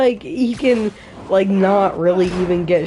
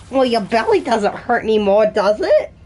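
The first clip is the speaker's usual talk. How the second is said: put-on cartoon villainess doctor voice; Harley Quinn. Doctor teases Spider-Man about his tummy ache, in character voice. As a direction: play a teasing character, laugh creeping in at the end. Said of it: "your best Harley impression yet".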